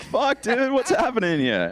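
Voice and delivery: high, panicky mock alarm; squeaky freaked-out disbelief. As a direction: play the stoned panic. Panicked inner reaction retold.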